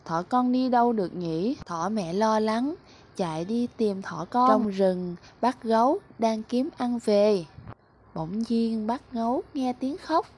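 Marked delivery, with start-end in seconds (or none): none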